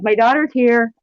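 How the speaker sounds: high, loud, flustered alarm; excited. Retold or imitated. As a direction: squeal it out in a panic. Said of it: "And I'm like".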